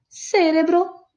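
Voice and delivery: Cerebro said the Portuguese way: cé-re-bro, with a clear s sound and stress on the first syllable; Portuguese pronunciation. Shown as a demonstration. Teacher giving the Portuguese form of Cerebro, stressed on CÉ. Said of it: "portugués".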